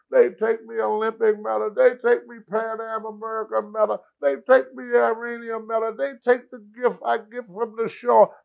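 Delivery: high, wailing lament over stolen medals; wailing Sheik. Retold or imitated. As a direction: whine it out high like a heartbroken wrestler. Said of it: "She go".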